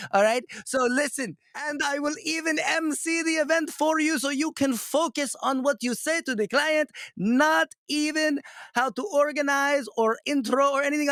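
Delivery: high-pitched, hammed-up Indian accent. Coach doing his joke sales pitch for a client, thick Indian accent. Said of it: "with this Indian accent".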